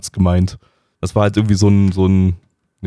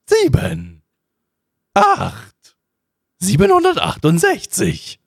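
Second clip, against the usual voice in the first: counting in much higher squeaky bursts; Graf Zahl; 13 semitones higher, much slower than usual. Pretend. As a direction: shriek each number in a silly count voice. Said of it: "ich bin Graf Zahl".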